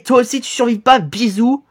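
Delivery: ordinary talk, nothing marked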